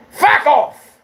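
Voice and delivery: sudden high, loud yell; explosive, high-pitched yelled dismissal; angry shout. Real, not acted.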